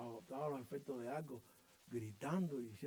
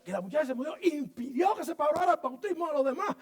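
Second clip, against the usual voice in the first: building from raised voice to a high, loud preaching cry; rising preaching shout; 10 semitones higher, 11 dB louder than usual. Real, not acted. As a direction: let it climb into a full pulpit shout. Preacher rising to full force.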